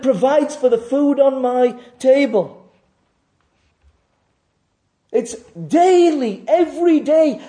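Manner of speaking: high, loud, driving pulpit voice; fervent sermon climax; full-force preaching. Acted. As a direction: hammer each "must be" home. Preacher rises again fervently.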